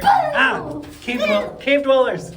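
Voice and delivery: high startled yelp. Real, not acted. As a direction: jump at something in the dark. Startled in a dark tunnel.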